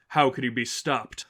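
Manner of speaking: ordinary delivery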